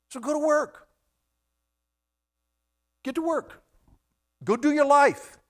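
high, loud preacher's exhortation; preaching at full force. Acted. Preacher urging the congregation, high and loud. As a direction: rouse them to get to work.

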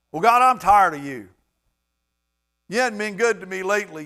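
loud, high mock tirade at God; hammed-up grievance; acted complaint. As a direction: play a fed-up believer protesting loudly.

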